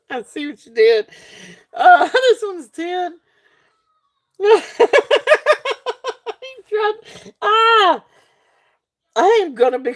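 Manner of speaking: high, tearful laughter; laughing till crying. Real, not acted. Seller caught out by a viewer, laughing to tears. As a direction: laugh so hard it nearly cries.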